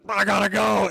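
high-pitched imitation of a boss bolting; mock hurried exit. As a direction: blurt it out like you're suddenly fleeing. Acting out boss rushing off.

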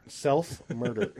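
self-murder drawn out slowly with a rise; slow repeat. Shown as a demonstration. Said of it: "repeats it slowly".

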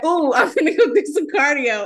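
high, loud mock enthusiasm; eager self-imitation. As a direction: mimic yourself keen to work out. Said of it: "when I try to be like".

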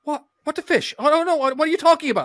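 put-on high, loud bewildered child's squeal; confused shock. Young boy baffled by his mom, high and loud. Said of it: "I'm like".

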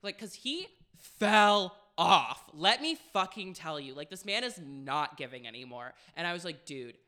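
loud, indignant sass; mock outraged. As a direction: scold him with diva outrage. Retold indignant comeback.

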